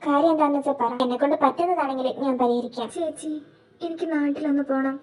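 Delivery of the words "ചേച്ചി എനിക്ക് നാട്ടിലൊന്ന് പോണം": soft, subdued acted worry; troubled confiding. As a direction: confide quietly, as if fearing a loss. Troubled confession to older sister.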